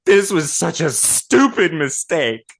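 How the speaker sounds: put-on upset kid voice. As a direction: play the tearful boy lamenting. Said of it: "He goes".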